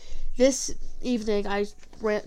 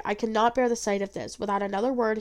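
plain reading voice

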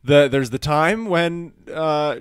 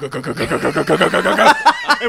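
gurgling pipe noise; 3 semitones higher than usual. Retold or imitated. Imitating gurgling pipes.

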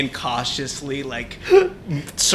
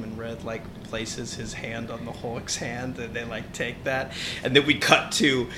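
normal talking voice

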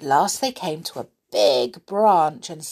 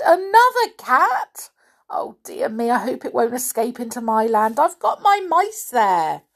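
high, flustered worry; anxious; 6 semitones higher, 5 dB louder than usual. A narrator's reading. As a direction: fret in alarm, voice pitched up. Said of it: "looked at him anxiously".

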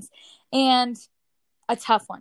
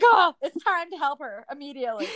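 higher, mock-urgent alarm; anxious overreaction; 5 semitones higher than usual. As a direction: act out level-ten anxiety. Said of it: "my anxiety is, like, level ten".

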